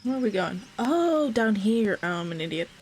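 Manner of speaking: normal talking voice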